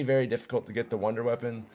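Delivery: ordinary delivery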